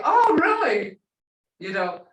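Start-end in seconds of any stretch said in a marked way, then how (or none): 0.0-1.0 mock surprised listener, higher and louder; surprised reaction. Listener replies to the cat news, surprised. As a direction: act out an interested "oh, really?" reaction.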